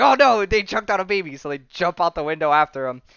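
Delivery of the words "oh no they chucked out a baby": higher, louder hammed-up dismay; mock alarm. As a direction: play a hero's comic panic. Retold heroes alarmed at baby.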